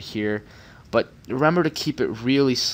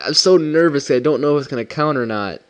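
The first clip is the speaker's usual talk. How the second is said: nervous excitement; 4 semitones higher, 6 dB louder than usual. Real, not acted. Anxious waiting for game result.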